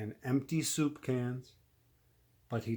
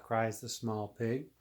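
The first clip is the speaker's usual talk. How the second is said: plain reading voice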